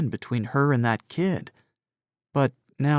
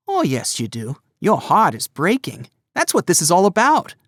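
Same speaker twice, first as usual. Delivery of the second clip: teasing cousin; 6 semitones higher, 4 dB louder than usual. A narrator's reading. Gus teasing about crush.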